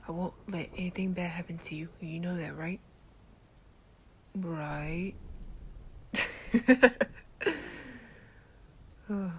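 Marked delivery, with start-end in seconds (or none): 5.2-8.4 short burst of chuckling, higher and louder; laugh. Acted. Boyfriend amused by the listener, laughing. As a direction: chuckle out loud.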